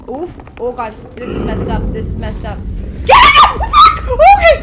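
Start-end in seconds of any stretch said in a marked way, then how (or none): 3.0-4.6 real very high, loud shrieks half laughing; shrieking in fright. Real, not acted. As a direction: shriek at the sudden scare. Jump scare in game.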